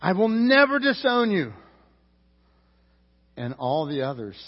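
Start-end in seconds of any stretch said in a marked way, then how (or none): 0.0-1.5 rising to a loud, higher protest; emphatic insistence. Acted. Peter vows loyalty to Jesus, insisting loudly and emphatically. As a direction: Peter swears he'll never deny him, building to a loud vow. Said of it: "Peter insisted emphatically".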